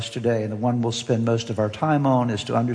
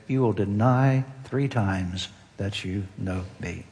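solemn Jesus; 4 semitones lower, 5 dB quieter than usual. Retold or imitated. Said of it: "Jesus answered".

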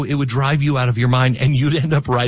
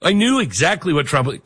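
usual speaking voice

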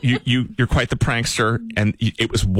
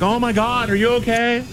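mock worried wife; 11 semitones higher than usual. Wife's worried reply voiced.

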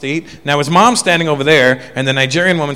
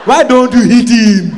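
slow, loud, higher demand; hammed-up shouted urging to hit the kid; mock Nigerian woman; 8 semitones higher, 6 dB louder, slower than usual. Nigerian woman's look voiced.

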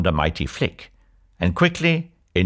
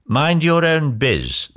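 higher, drawn-out retort; curt rebuff; 6 semitones higher, slower than usual. A narrator's reading. Prince answers nosy Cinderella, snapping a rebuff. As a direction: brush off the question slowly.

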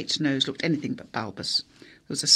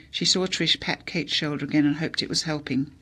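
ordinary delivery

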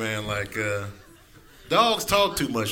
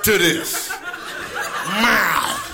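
raised, demanding voice of a hungry cat; yelling, indignant cat; 4 semitones higher than usual. Acted. Hungry cat confronting its roommate over an empty dish, indignant. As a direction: scold the owner, high and forceful. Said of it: "cats are yelling at you when they're hungry".

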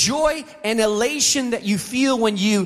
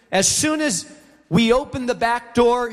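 ordinary talk, nothing marked

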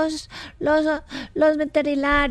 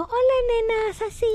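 tender greeting; 6 semitones higher than usual. Retold or imitated. Child imitates host's sweet face and voice, tender. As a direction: mimic someone's tender greeting, lightly. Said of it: "la cara tierna que Gabris me hizo".

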